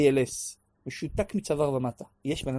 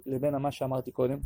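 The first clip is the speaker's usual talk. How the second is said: ordinary delivery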